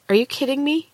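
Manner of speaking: high, stunned question; disbelief. A narrator's reading. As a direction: jaw dropped, can't believe it. Shocked at the shimmering woman.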